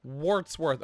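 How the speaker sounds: Wartsworth with a clear R, WARTS-worth. Shown as a demonstration. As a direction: say Wartsworth, not Watsworth. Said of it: "I've been calling him Watsworth".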